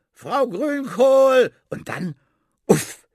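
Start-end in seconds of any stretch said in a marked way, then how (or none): 0.1-1.5 a long, drawn-out high shout; calling out. Acted. Herr Taschenbier yelling for the landlady, long and loud. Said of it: "Herr Taschenbier ruft". 2.6-3.1 a loud burp sound. Acted. Rat burping after too many sausages, loud. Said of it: "die Ratte rülpst".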